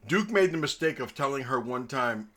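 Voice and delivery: ordinary talk, nothing marked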